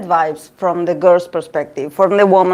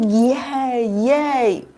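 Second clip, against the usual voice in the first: drawn-out, higher-pitched little yay; giddy inner cheer; 5 semitones higher than usual. Acted. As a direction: cheer like a giggling fan. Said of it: "I just giggle inside".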